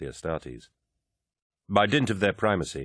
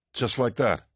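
sour; 6 semitones higher than usual. A narrator's reading. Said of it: "the army officer asked sourly".

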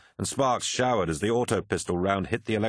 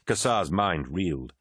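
usual speaking voice

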